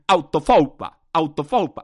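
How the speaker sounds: loud bursts on a made-up word; shouted nonsense word. Real, not acted. Podcaster lets loose autofoupas like a madman, shouting. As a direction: shout it like there's no tomorrow. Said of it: "sé que estoy gritando".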